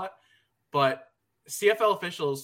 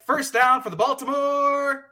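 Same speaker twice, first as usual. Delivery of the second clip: loud, high, drawn-out announcer call; stadium announcer; 11 semitones higher, 7 dB louder, slower than usual. Retold or imitated. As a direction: boom it like a stadium PA. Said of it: "The announcer does something fun where he goes".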